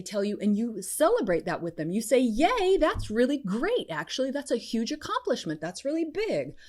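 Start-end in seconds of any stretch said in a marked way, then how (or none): 2.2-6.5 high, celebratory praise; enthusiastic congratulations. Acted. Coach models celebrating a friend's win, enthusiastic congratulations. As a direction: cheer them on with pitch lifted.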